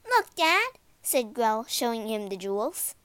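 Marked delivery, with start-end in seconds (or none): 0.1-0.8 put-on high, loud child's voice; excited girl. A narrator's reading. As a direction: call Dad over eagerly.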